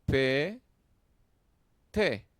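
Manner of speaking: "pe, te" as two loud, high, separate syllables; "pe, te" counted out one syllable at a time. Shown as a demonstration. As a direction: punch "pe, te" with a long pause between. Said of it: "Tres sílabas".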